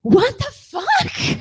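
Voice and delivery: drawn-out high shocked what the fuck; high, slow stunned outburst. Retold or imitated. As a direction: play his stunned disbelief, slowly. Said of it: "he just goes".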